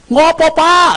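high-pitched mock call from afar; calling back. Son far off shouting back to his father, puzzled. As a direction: call out as if you can't hear.

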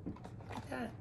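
whisper. Real, not acted. Teacher whispers while showing back cover.

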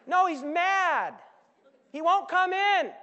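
high, loud and slow; shouted correction. Acted. Servant telling the dad over the music. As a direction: shout it slowly over the party.

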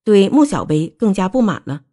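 plain reading voice

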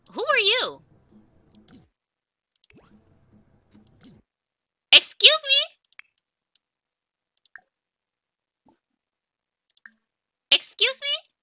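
high, loud indignant excuse me; sassy challenge. Pretend. Player confronting a Sim who took her book, high and indignant. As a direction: demand an answer, outraged.

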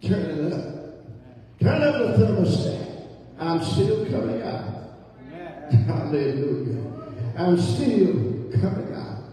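loud, rising preacher cadence, calling out to the church; fiery preaching. Acted. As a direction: preach it at full force, climbing. Preacher building to full force.

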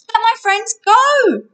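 high, loud, drawn-out demand; demanding shout. A narrator's reading. Hero to giant holding his friends, demanding shout.

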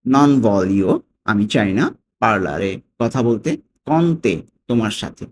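"Non voglio", "parlare", "con te" each set apart, followed by its translation; broken into pieces. Shown as a demonstration. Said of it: "আমরা ভেঙে ভেঙে যদি পড়ি".

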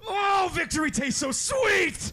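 slow high gloating shout; triumphant shout. Pretend. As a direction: savor the win loudly.

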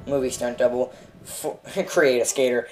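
usual speaking voice

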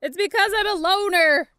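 high, loud, hammed-up wailing; loud lament. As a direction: cry it out in mock despair. Lamenting being a loner.

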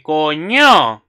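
loud startled swear; shocked exclamation. Acted. Ryuto sees the photo, shocked exclamation. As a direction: blurt it out loud, as if caught off guard.

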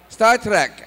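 high, loud Scottish mishearing; Scottish father. Retold or imitated. Dad mishearing son's show, loud Scottish. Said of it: "my parents have a very thick Scottish accent".

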